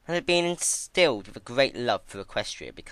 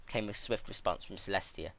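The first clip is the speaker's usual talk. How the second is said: usual speaking voice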